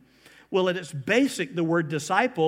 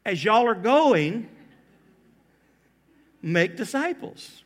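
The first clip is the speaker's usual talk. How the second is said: Southern drawl; 2 semitones higher, 4 dB louder, slower than usual. Pretend. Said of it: "Or if he were from the South".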